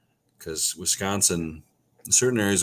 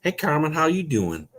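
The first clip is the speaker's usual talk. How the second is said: amateur Southern twang, pitched up; Southern accent; 9 semitones higher than usual. Shown as a demonstration. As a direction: greet her in a hammed-up Southern accent. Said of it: "I can do a southern accent".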